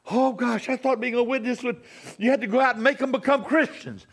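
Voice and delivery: high, loud mock protest; mock dismayed objector. Preacher voices an objector to witnessing, mock dismay.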